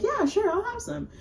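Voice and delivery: way up high, over-polite yes; high forced agreement. Retold or imitated. Tourist accepting a drink.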